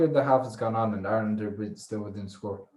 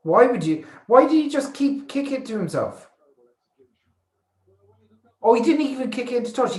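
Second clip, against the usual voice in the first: high, raised-voice outrage; exasperated disbelief; 12 semitones higher, 5 dB louder than usual. Real, not acted. Fan lamenting a botched kick to touch, exasperated. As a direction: wail it in real disbelief, pitched right up.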